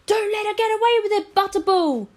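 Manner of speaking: high, fast jeering yell; jeering shout. Acted. Older brother goading the boys, high fast shout. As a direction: egg him on loudly.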